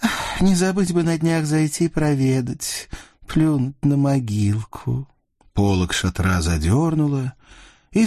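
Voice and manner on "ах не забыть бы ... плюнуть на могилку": ведьма. Acted. Ведьма злорадствует у шатра.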